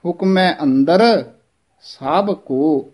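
slow, with a short pause after ਅੰਦਰ; ਅੰਦਰ held, then a pause. Shown as a demonstration. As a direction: stop briefly on ਅੰਦਰ, then say ਸਭ ਕੋ. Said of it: "ਅੰਦਰ ਪਦ ਤੇ ਥੋੜ੍ਹਾ ਜਿਹਾ ਠਹਿਰਾ".

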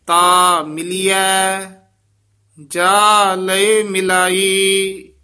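loud, measured scripture reading; full-voiced recitation. Teacher reciting scripture aloud for students, full voice. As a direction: recite it out boldly for the class.

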